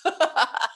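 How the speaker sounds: short voiceless laugh; an amused laugh caught in the breath; silent laugh. Real, not acted. Laughs at transport joke.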